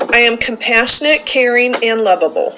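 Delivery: loud call-and-response recitation, each line declared for the group to repeat; loud group-leader recitation; leading a creed. Acted. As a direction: proclaim it to the room like a rallying creed. Leads daily creed recitation.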